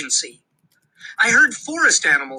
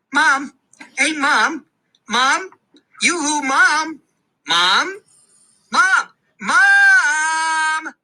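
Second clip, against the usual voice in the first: high, louder calls for Mom; calling out; 9 semitones higher, 3 dB louder, much slower than usual. Acted. Boy trying to get his busy mom's attention, calling out. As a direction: keep calling her, rising to a shout.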